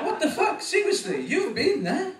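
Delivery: normal talking voice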